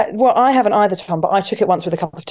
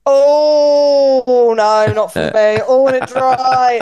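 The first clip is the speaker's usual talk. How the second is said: mum refusing in a drawn-out pained whine; slow, put-upon mock wail, a touch louder; imitating mum; 3 semitones higher, 4 dB louder, much slower than usual. Daughter mimicking mum turning down expensive wine, whining. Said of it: "Mum was like".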